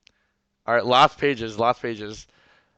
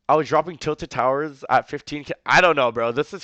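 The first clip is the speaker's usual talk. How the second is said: louder, slowed, incredulous; incredulous outburst; 4 semitones higher, 11 dB louder than usual. Real, not acted. Streamer to chat about being fifteen, incredulous loud outburst. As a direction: blurt it out in disbelief. Streamer reacting to the age detail.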